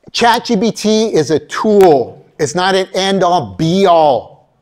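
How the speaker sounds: usual speaking voice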